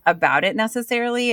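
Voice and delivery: normal talking voice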